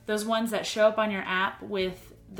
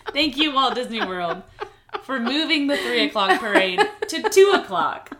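laughing; 4 semitones higher, 7 dB louder than usual. Real, not acted. Mock thanks while laughing.

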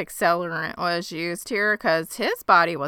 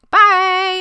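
high, loud chirpy farewell; loud sing-song bye; 12 semitones higher, 10 dB louder than usual. Retold or imitated. Detective leaving the suspects with a loud bye. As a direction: toss off a loud, bright goodbye. Floss abruptly leaves.

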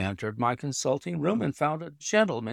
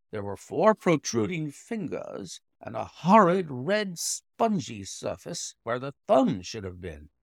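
plain reading voice

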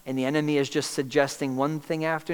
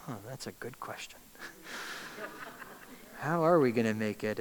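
much softer, half to oneself; hushed doubting murmur; 2 semitones lower, 8 dB quieter than usual. Acted. Believer caught by the enemy's question, musing hushed to themself. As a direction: muse on it quietly, hooked by the doubt.

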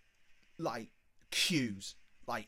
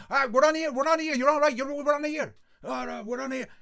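high, loud, frantic repetition; excited shouting; 10 semitones higher, 8 dB louder than usual. Retold or imitated. Lads piling onto the bus, excited shouting. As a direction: gabble it at the top of your voice.

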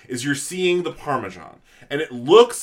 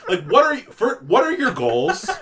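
ordinary talk

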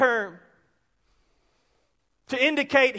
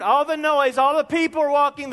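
ordinary talk

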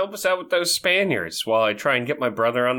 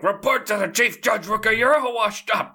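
J. Jonah Jameson yelling; 8 semitones higher than usual. Retold or imitated. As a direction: bark it like an angry editor. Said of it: "screams at that dude".

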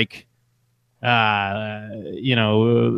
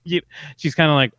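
ordinary talk

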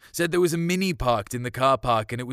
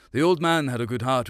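usual speaking voice

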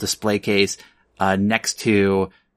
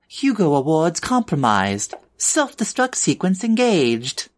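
raised-pitch automated warning; mechanical voice; 7 semitones higher than usual. Acted. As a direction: announce it like an alarm system. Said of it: "a mechanical voice going".

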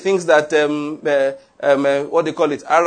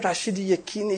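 ordinary delivery

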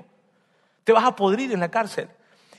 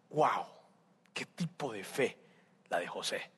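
much softer, lower marveling; hushed awe; 9 semitones lower, 14 dB quieter, slower than usual. Real, not acted. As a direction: let the wonder drop to a hush. Preacher marvels at José's faith. (asombro en voz baja y grave)

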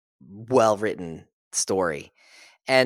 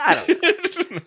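much higher, laughing, skeptical shrug; laughing doubt; slower than usual. Retold or imitated. Doubting the boastful readers with a high, laughing shrug. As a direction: laugh it off in disbelief.

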